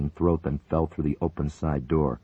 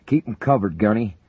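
loud, higher order over the intercom; yelling; 6 semitones higher, 6 dB louder than usual. A narrator's reading. Major ordering the gunner to keep firing, yelling. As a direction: bark the order through the noise. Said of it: "Buchanan yelled over the intercom".

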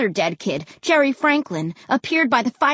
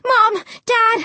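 high-pitched call to parents; excited cry; 12 semitones higher, 4 dB louder than usual. A narrator's reading. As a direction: call out urgently. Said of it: "I cried".

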